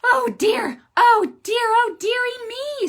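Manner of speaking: high, loud fussy fretting; squeaky oh dear fuss; fretting animals. Acted. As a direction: fret like worried little animals. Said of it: "says Cat and Dog".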